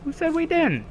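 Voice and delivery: high mock retort. Player talks back to sergeant.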